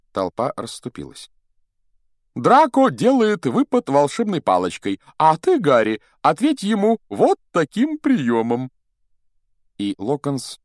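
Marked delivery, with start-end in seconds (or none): 2.3-8.7 high, loud, showy teacher; instructing Lockhart. Acted. Teacher instructing two boys before their duel, showy and high. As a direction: explain the move in a high, projected voice.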